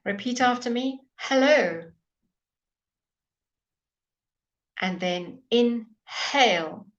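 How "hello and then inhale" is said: Hello with a big breathy H; teacher modelling aspirated h; exaggerated h. Shown as a demonstration. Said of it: "I'm exaggerating".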